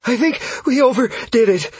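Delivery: high, broken phrases out of breath; winded gasping. A narrator's reading. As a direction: gasp it after a sprint. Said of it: "Caius gasped".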